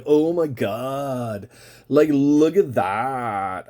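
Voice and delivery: put-on slow, drawn-out valley girl "Oh my God"; mock a bubbly California girl, stretching every word. Vlogger mocking the California voice she denies having, drawn-out valley drawl. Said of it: "trying to be like the California voice where I'm like".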